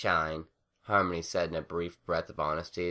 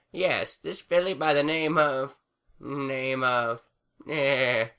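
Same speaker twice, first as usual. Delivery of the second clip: put-on old granny voice; 8 semitones higher, 5 dB louder, slower than usual. A narrator's reading.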